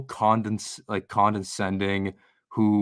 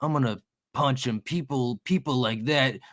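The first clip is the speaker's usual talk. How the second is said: imitating a blustering streamer; 4 semitones higher than usual. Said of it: "I saw something where he's like".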